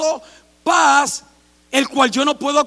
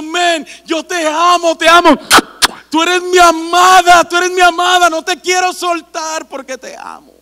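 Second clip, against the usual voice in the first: much louder, higher cries of love; God's loving shout; 3 semitones higher, 10 dB louder than usual. Acted. Pastor voicing God to the congregation, loving loud cries. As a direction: shout the love out like God calling his child. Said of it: "Dios que se manifiesta diciéndonos".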